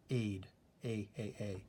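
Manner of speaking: "aid, A, A, A" as a long ay, like "day"; long A sound. Shown as a demonstration. Teacher sounding out the long vowel for students. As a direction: stretch the "A" into ay each time. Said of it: "So I'm saying that A sound".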